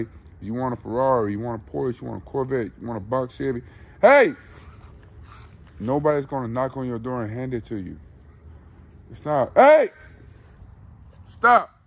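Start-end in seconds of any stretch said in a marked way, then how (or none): 4.0-4.3 loud, high startled yell; sudden shout. Real, not acted. Owner yelling at the dogs mid-rant, loud and high. 9.5-11.8 loud, high startled yell; sudden shout. Real, not acted. Owner yelling at the dogs mid-rant, loud and high.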